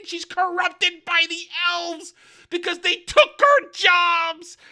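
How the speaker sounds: high, loud ranting preacher voice; mock zealot sister. Sister denouncing the queen to the crowd, shrill hammed-up rant. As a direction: rant at the crowd against the elves. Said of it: "Sister McGee and says".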